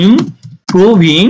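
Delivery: "Improving" slowly split, pausing after im before proving; syllable by syllable. Shown as a demonstration. Teacher modelling the word for learners, syllable by syllable. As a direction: stretch "improving" out in separated syllables.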